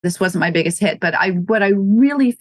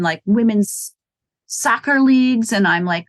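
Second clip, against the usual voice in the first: ordinary talk, nothing marked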